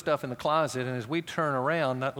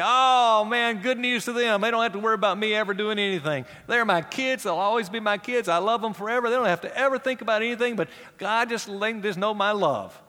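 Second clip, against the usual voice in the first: higher, louder gushing parent, fast in places; mock indulgent parent; 5 semitones higher, 4 dB louder than usual. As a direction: ham up the doting parent who never corrects.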